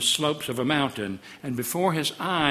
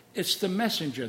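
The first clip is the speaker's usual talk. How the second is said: ordinary talk, nothing marked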